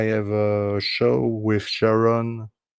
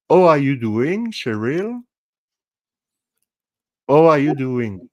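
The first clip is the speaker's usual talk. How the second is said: playful French accent, pitched higher, H dropped; 6 semitones higher, 5 dB louder than usual. Shown as a demonstration. Host showing Cheryl his accent for fun, hammed-up French accent. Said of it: "accent in French, it is".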